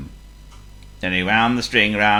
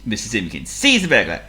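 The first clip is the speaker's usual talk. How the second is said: ordinary delivery, nothing marked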